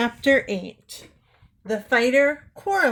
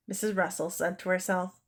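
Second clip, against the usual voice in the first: ordinary delivery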